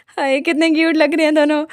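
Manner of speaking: high, gushing aw over a cute moment; gushing aside. Real, not acted. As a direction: swoon at the sweet hug, voice up. Narrator gushes over hugging pair. (ऊँची आवाज़ में प्यार से चहकना)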